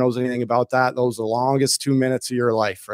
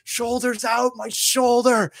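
high, strained yelling of a teammate's name; pleading yell to an opponent during a fight; retold pained yell; 9 semitones higher, slower than usual. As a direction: call out in pain mid-fight, pitched way up. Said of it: "And I'm yelling".

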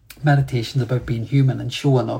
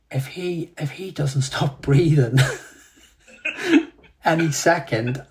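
mock irritated inner rant, laughing through it; 2 semitones higher, slower than usual. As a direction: seethe in jest, breaking into a laugh. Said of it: "I was just irritated and angry".

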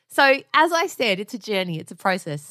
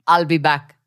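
put-on a louder Austrian Arnold accent on "I'll be back", lower and firm; "I'll be back" in a low Austrian Terminator growl, punched out; 6 semitones lower, 5 dB louder than usual.

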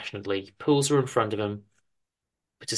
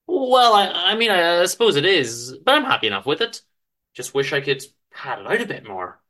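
hesitant, a touch higher and louder; flustered husband, a little hurt; lightly voiced Chester; 6 semitones higher, 4 dB louder than usual. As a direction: stammer your defence mildly.

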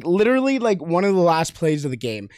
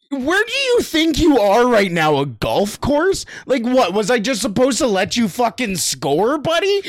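retold taunt; 6 semitones higher than usual. Confronting opponent on pitch.

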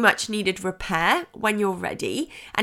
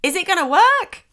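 ordinary talk, nothing marked

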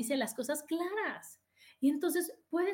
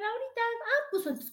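lightly voiced inner self, pitched high; high-pitched imagined reply; Adriana's inner reply; 10 semitones higher than usual. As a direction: answer back in a high, quick-to-reply voice. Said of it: "Adriana me va a responder".

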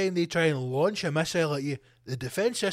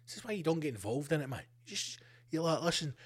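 muttered aside; 6 dB quieter than usual. Real, not acted.

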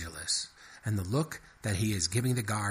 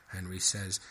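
usual speaking voice

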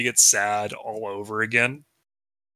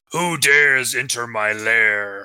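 growling dragon guarding treasure; slower than usual. Acted. Villain Alex Jones entering.

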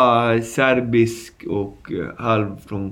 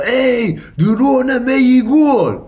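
high, loud, slow furious accusation; a stranger shouting an accusation in a high voice; angry accusing shout; 13 semitones higher, 5 dB louder, slower than usual. Retold or imitated. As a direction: yell it at the guy who robbed you. Angry victim confronting robber.

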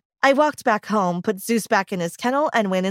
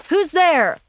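sharp call into the dark, higher and a bit louder; shouted; 7 semitones higher, 4 dB louder than usual. A narrator's reading. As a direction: call out nervously at a hidden noise. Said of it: "I shouted".

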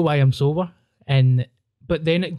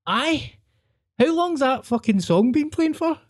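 much higher, baffled mock question; high-pitched disbelief; 11 semitones higher than usual. Tripping friends realise the song never ends, high baffled question. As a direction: play up the stoned confusion, pitched way up. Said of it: "And then I was like".

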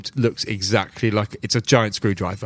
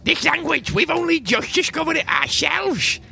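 much higher, serious little gnome voice; gnome spymaster Silas; 14 semitones higher than usual. Acted. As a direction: explain the secret gravely, tiny and earnest. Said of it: "He says".

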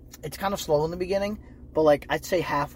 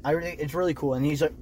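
ordinary talk

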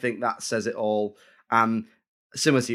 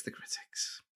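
suspense whisper; 19 dB quieter, slower than usual. Acted. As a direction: whisper it, teasing the reveal. Building suspense before the score.

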